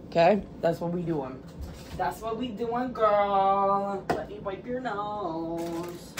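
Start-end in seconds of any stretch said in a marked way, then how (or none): none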